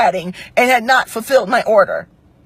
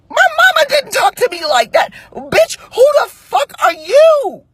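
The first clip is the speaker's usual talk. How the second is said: high-pitched, outraged comeback; high indignant outburst; indignant retort; 12 semitones higher than usual. Retold or imitated. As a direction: tell him off, pitched way up in outrage. Retelling her angry retort.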